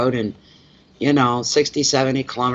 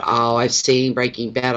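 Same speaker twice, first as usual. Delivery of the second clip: ordinary delivery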